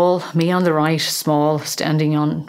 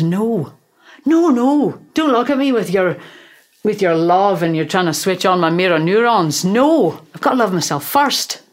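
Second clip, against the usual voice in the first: put-on a baby's protest in a Scottish accent, higher and louder on the nos; 4 semitones higher, 3 dB louder than usual. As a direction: play a stubborn wee Scottish baby. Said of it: "the Scottish accent sometimes is better".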